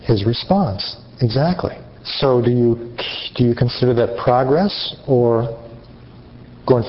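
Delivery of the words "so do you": usual speaking voice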